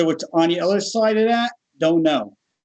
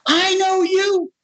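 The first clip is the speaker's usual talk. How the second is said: put-on excited kid recognizing him; 14 semitones higher than usual. Kid in the ER recognizing the miracle man, high excited voice. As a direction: imitate an awed kid, pitched way up. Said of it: "A kid sitting there, he's going".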